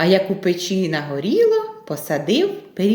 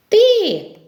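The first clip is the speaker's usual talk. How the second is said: high, loud mouse reply; answering little mice; 12 semitones higher, 5 dB louder than usual. Acted. Mice answer who found it, high mouse voice.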